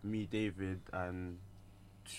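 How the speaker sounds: normal talking voice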